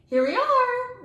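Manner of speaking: high, loud cheery announcement; announced. A narrator's reading. Teacher announcing arrival to the class, high bright voice. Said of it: "Miss Frizzle announced".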